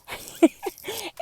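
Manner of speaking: high-pitched giggle; laugh. Real, not acted. Podcaster needing to practice what she preaches, giggling. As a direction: break into a high little laugh.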